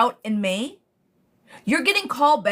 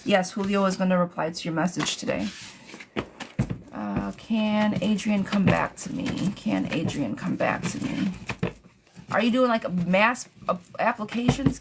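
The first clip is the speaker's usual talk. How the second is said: ordinary delivery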